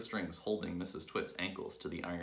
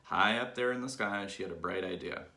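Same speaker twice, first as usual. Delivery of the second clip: usual speaking voice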